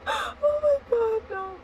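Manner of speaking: high, slow words through laughter; breaking up laughing. Real, not acted. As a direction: gasp it out while cracking up. Still laughing at the fall.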